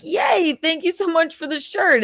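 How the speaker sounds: high-pitched mock gushing thanks; playful, exaggerated high "yay"; making fun. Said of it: "I was just making fun".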